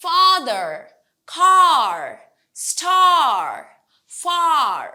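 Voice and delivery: FATHER, CAR, STAR, FAR with a long open aa; loud, drawn-out aa in father, car, star, far; long vowel. Shown as a demonstration. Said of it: "long word".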